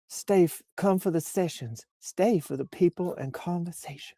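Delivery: high, soft Southern Belle voice. Pretend. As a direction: read it as a sweet-talking Southern Belle. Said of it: "we did her voice in a Southern Belle voice last week".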